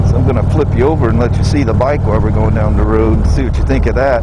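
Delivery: talking loud over road and wind noise on a moving motorcycle. Real, not acted. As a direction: project the voice over the engine and wind. Talking while riding motorcycle in wind.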